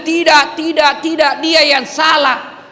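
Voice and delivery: mock stubborn denial. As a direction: play the prisoner who won't admit guilt. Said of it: "Yang kau malah bilang".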